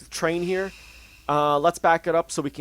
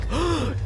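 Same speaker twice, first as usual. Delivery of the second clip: dramatic gasp; 13 semitones higher, 3 dB quieter than usual. Retold or imitated. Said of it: "the game's like".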